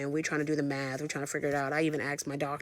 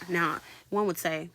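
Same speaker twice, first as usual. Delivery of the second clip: ordinary talk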